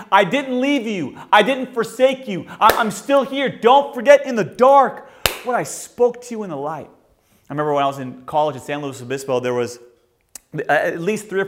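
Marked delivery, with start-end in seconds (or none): 0.1-6.9 voicing God. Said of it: "and God is saying".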